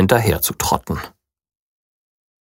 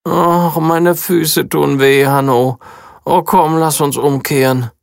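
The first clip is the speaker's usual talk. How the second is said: lightly voiced weary princess; whiny princess; 6 semitones higher than usual. A narrator's reading. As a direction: moan about sore feet like a spoiled princess. Princess whining, feet hurt.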